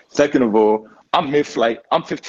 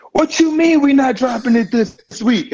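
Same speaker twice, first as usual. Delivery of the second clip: incredulous; 13 semitones higher, 4 dB louder than usual. Retold or imitated. Brian retold in disbelief.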